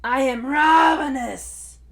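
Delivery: big loud hungry roar. Acted. Hungry Tai spots a long neck, big roar. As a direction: bellow it across the swamp. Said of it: "roared Tai".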